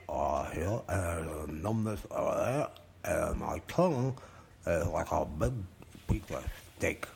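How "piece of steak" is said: usual speaking voice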